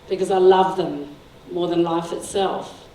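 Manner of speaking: normal talking voice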